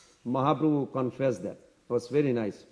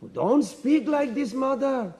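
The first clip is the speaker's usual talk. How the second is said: indignant rebuke; 9 semitones higher, slower than usual. Retold or imitated. Lakshman rebukes the mother.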